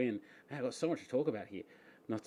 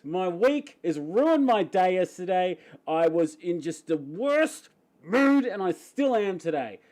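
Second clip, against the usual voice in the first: louder, fired-up and frustrated; footy rant; 4 semitones higher, 7 dB louder than usual. Real, not acted. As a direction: vent about the loss, worked up. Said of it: "Everyone's gonna talk at me about football".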